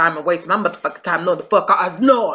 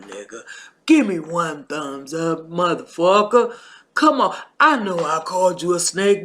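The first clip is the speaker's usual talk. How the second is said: ordinary talk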